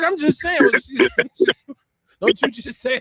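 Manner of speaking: high, laughing mock innocence; sheepish laughing excuse. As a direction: squeak it out through a laugh, playing dumb. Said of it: "I'm like".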